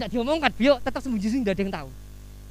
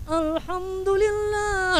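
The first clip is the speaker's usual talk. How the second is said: higher, very slow drawn-out Alhamdulillah; put-on devout drawl for the future in-laws; showing-off piety; 9 semitones higher, much slower than usual. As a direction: stretch Alhamdulillah out sweetly as the in-law passes. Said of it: "Langsung berubah nadanya".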